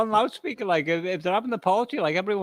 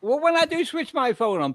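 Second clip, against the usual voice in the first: ordinary delivery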